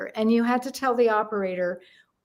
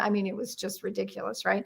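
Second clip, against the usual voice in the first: normal talking voice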